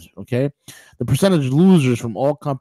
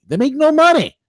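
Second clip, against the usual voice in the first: high-pitched, louder burst of disbelief; exasperated outburst; 5 dB louder than usual. Real, not acted. Host ranting about Amazon's stock, exasperated outburst. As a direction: cry it out, exasperated. Ranting at unprofitable company's valuation.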